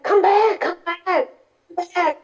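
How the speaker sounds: a high, mock-angry call after the boat; furious Devadatta shouting from the shore. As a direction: call him back in a rage, pitched high and fading. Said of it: "He was so angry".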